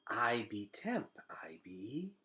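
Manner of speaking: slow, breathless whisper of a shy bug; roach whisper. Acted. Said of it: "he said".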